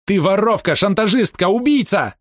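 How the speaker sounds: high-pitched furious accusation; enraged shriek. A narrator's reading. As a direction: hurl each accusation as a cry. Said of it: "взвизгнул он вне себя от гнева". (гневно, с визгом)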